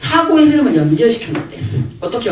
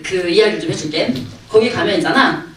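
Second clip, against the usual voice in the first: ordinary delivery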